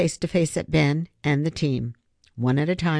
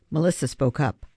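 ordinary delivery, nothing marked

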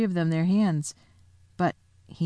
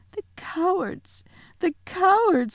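put-on much higher, sobbing girl's voice; sobbing Susan; 13 semitones higher than usual. A narrator's reading. Susan sobbing as the lion is tied. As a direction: cry it out in grief. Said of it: "sobbed Susan".

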